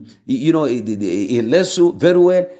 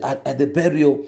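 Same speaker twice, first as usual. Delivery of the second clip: usual speaking voice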